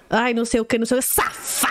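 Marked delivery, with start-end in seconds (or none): 1.1-1.6 mock read-aloud "Safada", stretched with punched syllables; exaggerated intonation stretched across "Safada"; imitating emphatic reading. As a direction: imitate someone over-acting the word "Safada". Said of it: "uma entonação na voz".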